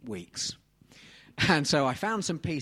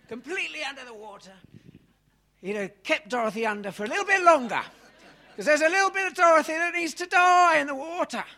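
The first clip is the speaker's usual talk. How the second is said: rising high and loud as he plunges her under; hammed-up baptism, climbing to a near shout; dramatic dunking; 12 semitones higher, 7 dB louder than usual. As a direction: act out holding her down, building to a shout. Mock baptism of Dorothy, dramatic.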